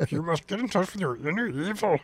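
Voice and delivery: a hint of the old Jedi master; Yoda voice. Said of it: "you had the voice okay".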